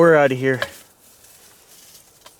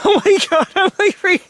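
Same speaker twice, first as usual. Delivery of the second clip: much higher, tumbling nervous rush; genuinely spooked, voice leaping up; real freaking out; 10 semitones higher than usual. Real, not acted. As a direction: panic breaking through the words. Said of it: "this is really me freaking out".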